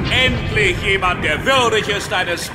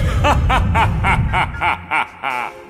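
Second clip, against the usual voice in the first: long, descending pompous guffaw; rolling theatrical laughter; amused laugh. Acted. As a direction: laugh scornfully as a mighty god. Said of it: "ist das ja auch so komisch".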